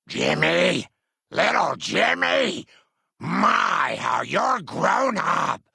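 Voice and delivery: a granny's loud, happy greeting, slow on the last words; delighted calling out. Acted. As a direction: call out with delight at seeing him grown.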